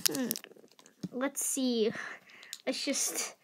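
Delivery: soft unvoiced mutter; whisper. Real, not acted. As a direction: murmur to yourself. Whispered aside while fiddling.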